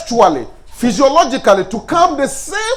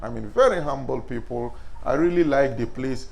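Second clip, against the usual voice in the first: soft flattering praise; 9 semitones lower, 10 dB quieter than usual. Shown as a demonstration. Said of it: "Oh, this is my name".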